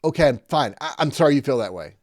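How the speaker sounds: hammed-up sulky non-apology; grudging non-apology. Shown as a demonstration. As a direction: snap the fake apology out. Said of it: "Don't do that".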